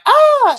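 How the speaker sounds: high retold yelp of fright; startled shriek. Narrator replaying the cook's shriek of fright. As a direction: shriek like you just got ambushed. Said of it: "be like".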